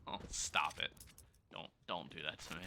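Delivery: hushed, half-laughing plea to stop; pleading softly. Real, not acted. Caster teased by chat, pleads in a hushed voice. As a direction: beg chat to quit teasing, barely voiced.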